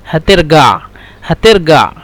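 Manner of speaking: هترجع said haterga with a hard G; Egyptian hard g. Shown as a demonstration. Teacher drilling the Egyptian G in هترجع. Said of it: "لو نحن نستخدم جيم، يعني غين".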